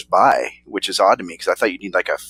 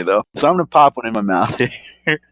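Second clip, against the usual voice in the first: ordinary talk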